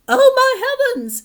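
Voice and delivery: high, loud shocked cry; cried out. Acted. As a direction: shriek it like a frightened mother. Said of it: "she cried".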